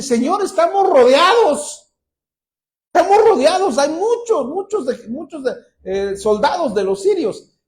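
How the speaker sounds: a frightened servant rushing in to warn his master; panicked servant. Retold or imitated. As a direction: servant bursting in to warn Eliseo of the army, alarmed. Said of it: "se mete corriendo y le dice".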